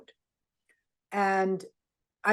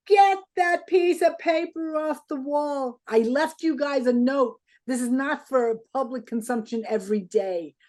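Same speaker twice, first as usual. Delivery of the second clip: retold angry outburst; 6 semitones higher than usual. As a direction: bark it like an irritated boss. John angry about the note on the wall.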